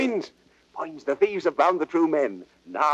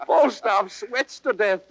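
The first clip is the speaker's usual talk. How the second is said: usual speaking voice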